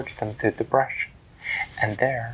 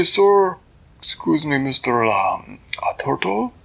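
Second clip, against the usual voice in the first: higher, formal and polite small hero; Flippertigibbet voice; 6 semitones higher, 4 dB louder than usual. Acted. Flippertigibbet greeting the turtle, high and polite.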